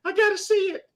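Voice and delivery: put-on high, whining child's longing; excited little kid. Little boy begging to see the movie, high and whiny. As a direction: beg like a kid who must see it. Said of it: "I was like".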